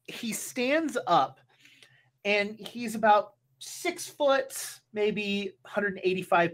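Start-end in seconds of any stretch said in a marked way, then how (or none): none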